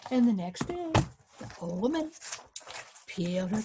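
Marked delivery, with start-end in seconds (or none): none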